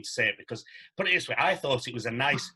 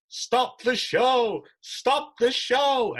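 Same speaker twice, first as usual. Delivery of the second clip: high, slow bellowed chant, much higher and louder; mock audience shout; 13 semitones higher, 7 dB louder, much slower than usual. Audience members yelling at the actors to stop, drawn-out shout. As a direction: yell it like an angry crowd. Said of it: "the audience was shouting".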